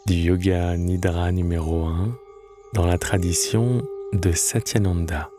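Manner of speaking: calm, measured Yoga Nidra voice with long pauses; guided meditation. Acted. Guide leading lying listeners into Yoga Nidra, calm guided meditation.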